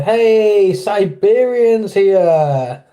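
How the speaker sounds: loud, high, drawn-out hail; hey, look who's here, slow and high; excited greeting. Real, not acted. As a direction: call out a long, delighted welcome. Calling out greeting to arriving viewer.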